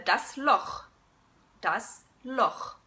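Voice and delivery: Loch ending in a throaty ch; German Loch. Shown as a demonstration. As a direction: rasp the ch in Loch. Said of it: "a couple of examples".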